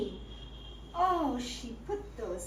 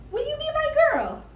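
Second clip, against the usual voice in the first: eager plea; 11 semitones higher, 5 dB louder, faster than usual. Acted. Suitor asks eagerly.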